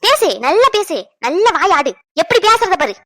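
loud, goading sarcasm; sarcastic scolding. Acted.

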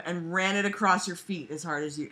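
normal talking voice

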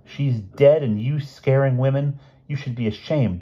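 high, indignant telling-off; indignant scolding. Acted. Lavinia shames the man for scaring women, indignant. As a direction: shame him with rising outrage.

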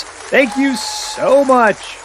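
high, drawn-out mock thanks; calling to crowd. Host thanking a live studio audience, calling out. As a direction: soak up imaginary applause.

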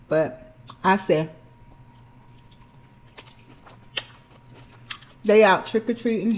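eating while speaking; talking while chewing. Real, not acted. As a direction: mouth partly full. Seen 2: Eats from plate throughout; mouth shows chewing between phrases.

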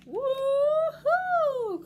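very high, loud whoop; gleeful cry. Acted. Little penguin soars out of the water, gleeful whoop. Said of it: "cried little Pip-Pip".

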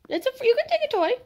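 high, loud, quick pet talk; eager, high-pitched invitation; excited coaxing. Real, not acted. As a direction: urge the dog on in a high voice. Coaxing dog toward toys.

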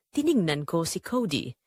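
ordinary delivery, nothing marked

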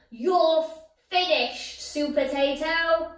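high loud villain shriek. Acted. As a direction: shriek it in triumph. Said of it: "he shrieked".

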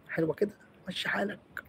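soft whispered aside. Real, not acted. Asking herself if it looks good, whispered. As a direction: whisper it under the breath.

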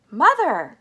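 high, loud call; excited cry. A narrator's reading. Charlie rushes to Missus Bucket, excited cry. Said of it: "cried Charlie".

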